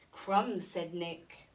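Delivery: ordinary delivery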